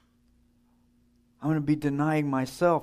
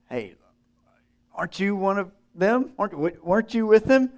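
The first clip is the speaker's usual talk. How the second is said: higher, pointed accusing question; accusing bystander; 7 semitones higher, 4 dB louder than usual. Retold or imitated. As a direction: call Peter out as one of them.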